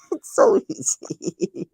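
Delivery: a very high, slow wail that breaks into laughter; half-laughing, pretending to sob over it; mock crying laugh. Mock sobbing to friends about shamrocks, laughing. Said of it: "it makes me cry".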